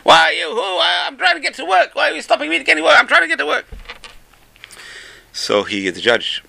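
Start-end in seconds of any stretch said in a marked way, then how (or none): 0.0-3.6 very high-pitched mock shouting; retold screaming. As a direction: imitate a frantic driver screaming at the policeman. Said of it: "He starts screaming at the policeman".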